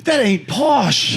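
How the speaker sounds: high, drawn-out common British voice; British accent. Acted. Teasing fellow comic's British accent, high drawn-out British voice. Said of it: "accent-wise".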